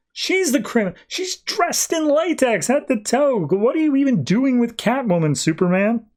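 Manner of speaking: high-pitched, incredulous mock indignation; indignant retort. Lois turns Catwoman's accusation back on her, indignant.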